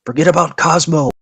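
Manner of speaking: high, loud mock scream; imitating an exasperated player screaming orders; imitated screaming. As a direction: yell it like a furious teammate. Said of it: "and just screaming".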